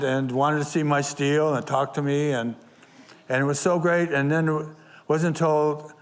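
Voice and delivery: ordinary talk, nothing marked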